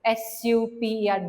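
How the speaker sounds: ordinary delivery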